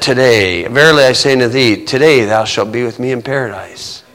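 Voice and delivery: gentle, spent voice of a dying man; dying whisper. Acted. Jesus on the cross promising the thief paradise, low and soft.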